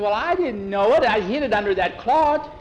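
put-on high, loud, defensive boy's plea; upset boy. Boy defending himself to the others, high and upset. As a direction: blurt it out, scared of blame.

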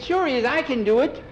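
put-on very high boy's voice; eager insisting. Skeeter insists he can ride.

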